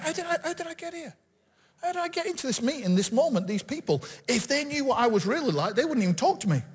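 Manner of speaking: high, hushed disbelief, voicing inner doubt; self-doubt. Speaker voices his thoughts among important people, incredulous self-doubt.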